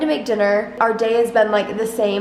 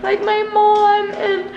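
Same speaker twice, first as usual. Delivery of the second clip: high, tearful voice breaking into sobs; crying while talking; 7 semitones higher than usual. Real, not acted. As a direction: talk through real tears, pitch climbing. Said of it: "that's why I'm crying harder as I'm even filming it".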